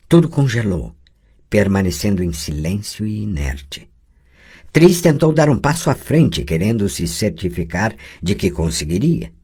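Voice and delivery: ordinary delivery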